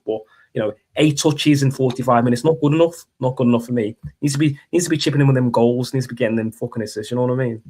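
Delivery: put-on thick Scouse accent; put-on Liverpool football pundit; Carragher. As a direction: argue the point in broad Scouse. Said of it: "You could do a good Carragher".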